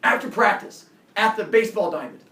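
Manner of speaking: tough challenge. Retold or imitated. Bully setting a fight time in front of the team, loud. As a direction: lay down the challenge loudly. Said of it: "He goes".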